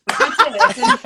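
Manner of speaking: slow, loud and giggly; laughing. Real, not acted. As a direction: push it out through laughter. Laughing at own story.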